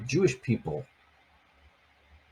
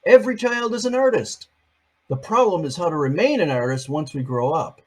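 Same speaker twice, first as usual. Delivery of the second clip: lifted, storybook reading of the quote; picture book reading voice; read-aloud quote voice; 4 semitones higher, 5 dB louder than usual. Acted. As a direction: read it out to children with a bright start.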